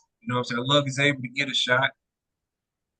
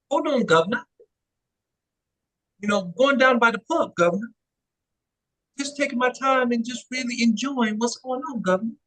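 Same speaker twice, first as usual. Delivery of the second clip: higher, hammed-up London accent; British accent; 5 semitones higher than usual. Friend performs a mock London accent for the host. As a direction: play a pub-going London bloke, badly. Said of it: "Trying to be from, uh, London".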